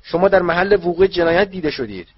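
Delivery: high, very loud raging accusation; mad with anger. A narrator's reading. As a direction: accuse at the top of the voice, furious. (فریاد از شدت عصبانیت)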